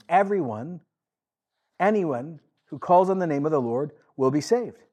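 a preacher declaiming the prophet's words; proclaiming scripture. Acted. Quoting Joel aloud.

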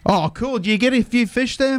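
normal talking voice

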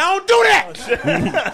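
high, stretched-out retold objection; mock protest. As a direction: protest high and slow, hamming it up. Retelling his protest at the impression.

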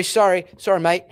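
mock Oxford English actor, climbing high and quick; imitated Oxford thespian, politely interrupting; Oxford actor accent. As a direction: put on a posh Oxford accent that rises as he asks. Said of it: "he's got this perfect Oxford".

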